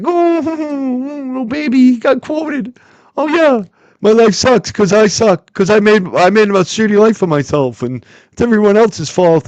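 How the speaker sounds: put-on sob-whine it like a big baby; mock baby voice. Streamer mocking rival Aiden as a whining baby. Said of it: "how much of a baby he is".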